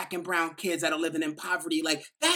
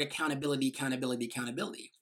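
each repeat lower and softer, sinking away; heavy, fading repetition; 6 semitones lower, 6 dB quieter, much faster than usual. Shown as a demonstration. Speaker to audience, mocking a dreaded word, fading repetition. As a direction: let the word weigh heavier every time.

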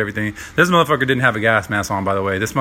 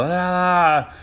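high drawn-out "ah" yell; mock yell; 8 semitones higher than usual. Trainee retelling the group yell in the chamber, mock yell. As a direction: imitate yelling without full volume. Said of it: "They start yelling, whatever that is, yell".

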